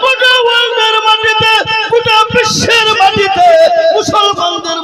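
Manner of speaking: full-force waz preaching; fiery preaching. Acted. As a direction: proclaim it to the whole crowd.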